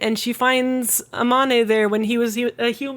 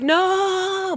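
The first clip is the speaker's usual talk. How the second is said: very high, stretched-out wail; mock despairing cry; 10 semitones higher than usual. Retelling her being teleported away, mock despairing cry. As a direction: draw the word out in a high mock protest.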